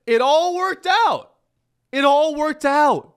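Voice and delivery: high, loud, slow sarcastic cheer; mock cheer. Sarcastic host cheering the Elon news to viewers, high and slow. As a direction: sell it like a fake victory lap.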